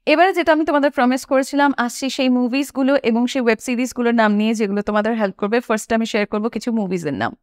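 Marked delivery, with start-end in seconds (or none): none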